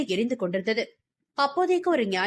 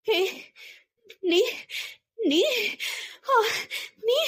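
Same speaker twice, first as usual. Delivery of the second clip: broken gasping words with long gaps; faltering, stammering; 12 semitones higher, slower than usual. A narrator's reading. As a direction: stumble over each word, dazed and scared. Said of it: "தடுமாறி போனவளின் தொண்டைக்குழி பலமாக நடுங்கியது".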